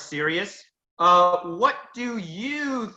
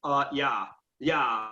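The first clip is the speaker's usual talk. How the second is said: mock monotone slacker; 5 semitones lower, 4 dB quieter than usual. Shown as a demonstration. Teacher showing students a bad speech, mock bored monotone. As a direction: demo how not to give a speech, bored. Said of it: "That sounds monotone".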